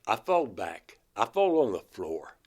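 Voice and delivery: usual speaking voice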